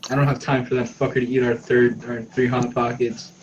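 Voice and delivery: ordinary talk, nothing marked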